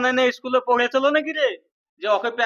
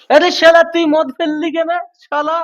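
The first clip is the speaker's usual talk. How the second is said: put-on loud drunken yell at a friend; belligerent drunk; 3 semitones higher, 7 dB louder than usual. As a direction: bellow it like a boozed-up brawler. Drunk friend yells over spilled liquor.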